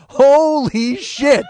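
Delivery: slow, high, loud holy shit; excited outburst. Acted. Bike cop gets a chance at crime, excited. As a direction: savor the moment, drawn out.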